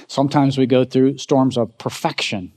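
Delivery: usual speaking voice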